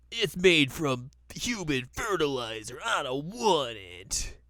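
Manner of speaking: drawn-out mock refusal, sliding pitch; whiny refusal. Inuyasha refuses the sage's potion, mock whiny protest. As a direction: drag the words out in exaggerated protest.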